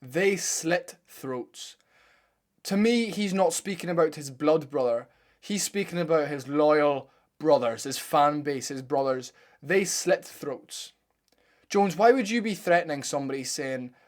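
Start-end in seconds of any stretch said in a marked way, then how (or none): 0.0-1.8 slow emphasis. Shown as a demonstration. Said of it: "repeats the clip phrase slowly". 9.6-10.9 slow emphasis. Shown as a demonstration. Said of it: "repeats the clip phrase slowly".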